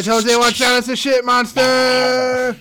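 warning shout. Pretend. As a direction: yell a warning to your friend.

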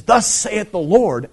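slow, high, loud prophet's cry; prophetic proclamation. Retold or imitated. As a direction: proclaim it like a prophet silencing a crowd. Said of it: "and he says".

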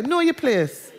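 Jamaican patois "Know your place", "place" said like "pleess", "your" a quick "yuh". Shown as a demonstration. Preacher showing the congregation his Jamaican mum's patois warning. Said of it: "how many of you can say it that way".

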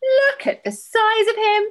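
high, loud, drawn-out exclamation; midwife's shout. Acted. Host reading the midwife's words about a huge baby, shouted. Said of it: "The next thing the midwife shouted was".

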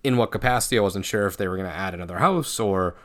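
usual speaking voice

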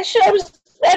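normal talking voice

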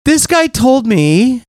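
high, slow, wounded complaint; imitated near tears. Husband tattling to his wife, high mock-tearful complaint. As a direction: whine it slowly in mock hurt. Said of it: "I was almost in tears".

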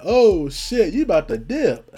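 loud, high comic realization; mock alarm. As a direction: blurt it out in exaggerated shock.

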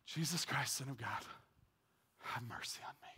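hushed whispered breath prayer. Shown as a demonstration. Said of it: "you know what I did for five minutes".

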